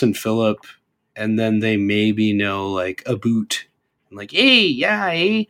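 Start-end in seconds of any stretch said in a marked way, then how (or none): 4.3-5.4 high, sing-song Canadian "Hey, yeah, eh?"; Canadian accent. Retold or imitated. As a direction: toss off a hammed-up Canadian eh. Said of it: "it's more like the, uh, Fargo accent".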